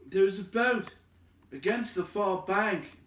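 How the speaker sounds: high, loud call across the water; eager hobbit crying out; excited cry. Acted. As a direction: shout out the find.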